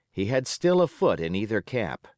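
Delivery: ordinary delivery, nothing marked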